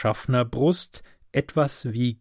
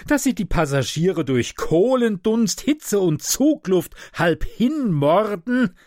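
put-on high, plaintive complaining old man; a sleepless sufferer wailing his grievances; whining invalid; 6 semitones higher than usual. Sleepless sick passenger scolding the conductor, higher whining voice.

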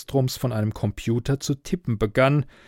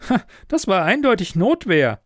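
higher-pitched, lightly laughing verdict; amused exclamation; 7 semitones higher than usual. A narrator's reading. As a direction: chuckle and declare it emphatically. Relaxed officer jokes about the case.

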